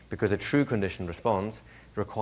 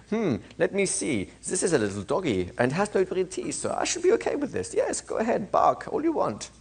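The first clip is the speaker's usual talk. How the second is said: put-on calm reasoning child; 8 semitones higher than usual. Teacher voicing Johnny calmly reasoning with the dog. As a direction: talk yourself calmly out of fear, tongue in cheek. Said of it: "analyzing the situation at this point and going".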